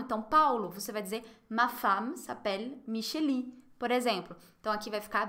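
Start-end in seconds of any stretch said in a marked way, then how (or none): 1.5-3.5 femme said as fam with an open a; slow, careful ma femme, the e sounding like a; pronunciation demo. Shown as a demonstration. Teacher showing Paulo how to say femme, slowly. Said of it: "M em francês fica som de A".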